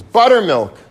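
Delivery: odd shout at the crowd; loud blurt. Retold or imitated. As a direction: fire the word off out of nowhere. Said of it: "he says".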